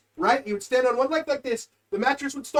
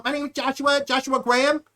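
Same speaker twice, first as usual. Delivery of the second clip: slightly raised, put-on voice of a cellmate; imitated odd accent, name repeated; mocking impression; 4 semitones higher than usual. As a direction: mimic his self-introduction as a prank. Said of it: "I would master his impression".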